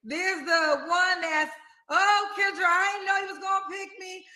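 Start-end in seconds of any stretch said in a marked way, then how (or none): 1.9-4.2 excited contestant. Retold or imitated. Host imitating excited picked Kendra Cam person. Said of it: "There's two type of Kendra Cam people".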